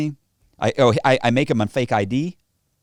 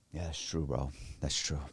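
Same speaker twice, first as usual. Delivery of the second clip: low, soft acted agreement; quiet concession; 8 semitones lower, 14 dB quieter than usual. Tough guy won over by flattery, low quiet agreement. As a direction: drop right down as the tough guy gives in.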